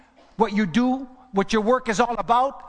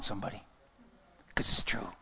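quiet aside; 11 semitones lower, 12 dB quieter, faster than usual. Real, not acted. Preacher aside to congregation.

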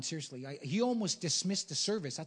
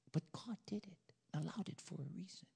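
hushed preacher whisper; 3 semitones higher, 8 dB quieter than usual. Real, not acted. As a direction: lean in and whisper it. Preacher drops to confidential hush.

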